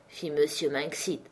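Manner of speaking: ordinary talk, nothing marked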